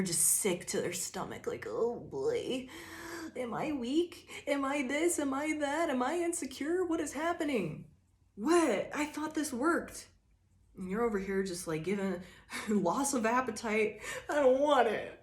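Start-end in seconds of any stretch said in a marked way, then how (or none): 1.7-10.1 high, anxious mock whine of self-doubt; whiny confused voice. Bully realizing he failed, in a high whiny mock voice. 14.3-15.2 put-on high, louder pouty kid refusal; grumpy kid. Hungry cranky kid refusing food, high pouty voice.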